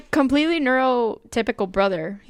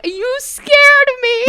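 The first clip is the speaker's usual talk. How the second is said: high, loud, drawn-out mock complaint; imitating sister-in-law's indignant cry; startled scolding; 9 dB louder, much slower than usual. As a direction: wail it slowly at the person who frightened you. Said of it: "and she's like".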